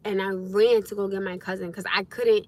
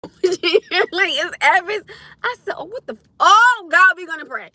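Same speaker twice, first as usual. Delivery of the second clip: higher pitched, laughing through the retold quote; laughing hard; 10 semitones higher, 9 dB louder than usual. Real, not acted. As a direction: squeeze the words out between laughs. Retelling while cracking up.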